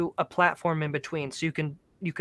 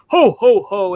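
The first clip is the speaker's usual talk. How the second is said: big booming ho ho ho, much higher and louder; Santa laugh; 4 semitones higher, 11 dB louder, slower than usual. Retold or imitated. Son imitating Santa's jolly laugh, booming. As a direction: belt it out like Santa.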